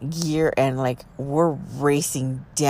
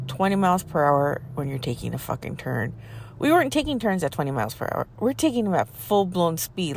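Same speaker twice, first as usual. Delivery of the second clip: normal talking voice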